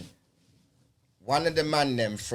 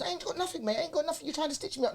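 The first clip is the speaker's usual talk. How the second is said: pleading suspect; 13 semitones higher, 7 dB quieter, faster than usual. Retold or imitated. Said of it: "he's talking".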